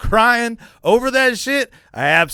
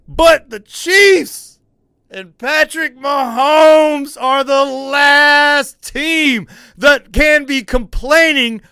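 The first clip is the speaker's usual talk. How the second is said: loud, slow, higher-pitched emphatic rant; 5 semitones higher, 5 dB louder, slower than usual. Real, not acted. Host ranting at the Chiefs about calls, loud slow rant. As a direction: drag out every word to hammer the point home.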